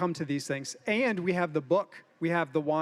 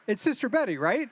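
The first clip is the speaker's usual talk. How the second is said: ordinary talk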